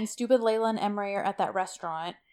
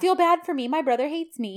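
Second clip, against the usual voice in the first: higher, louder, whiny mocking tone; mock self-pity; 7 semitones higher, 4 dB louder than usual. Podcaster mocks the brother's pity play, in a whiny, mocking voice. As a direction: mimic him fishing for sympathy.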